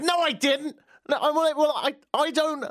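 high, protesting, stumbling over the words; hammed-up indignant denial; flustered mock denial. Driver denies honking himself, flustered and high. Acted quarrel over who honked.

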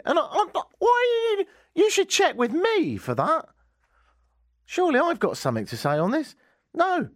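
very high, spluttering comic outrage; hammed-up affront, voice leaping up; indignant objection. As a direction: splutter in protest at being overlooked. Acts out objection at checkout.

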